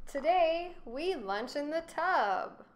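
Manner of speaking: high, loud announcing; grand proclamation. A narrator's reading. King's proclamation.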